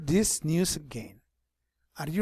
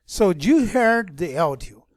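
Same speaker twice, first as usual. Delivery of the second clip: normal talking voice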